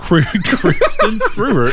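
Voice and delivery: "Kristen Stewart" slurred into a laughing "Kristen Sruwer"; "Stewart" mangled to "Sruwer" through laughter; garbled name. Shown as a demonstration. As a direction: stumble through "Kristen Stewart", high and drawn out. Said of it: "say her name correctly".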